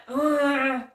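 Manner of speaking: loud, exasperated grunt of disgust; annoyed grunt. Retold or imitated. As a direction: let out the driver's fed-up ugh.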